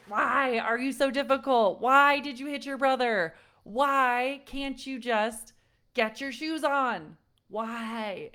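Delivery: mock scolding parent; frustrated parent. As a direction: nag the kids in exasperation. Parent scolding kids, acted.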